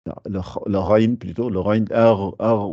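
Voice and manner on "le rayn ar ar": "ar" gargled at the back of the throat; throaty ar. Shown as a demonstration. Teacher has students feel the rayn in the throat. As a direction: let them feel "ar" rasp in the throat. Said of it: "vous le sentez".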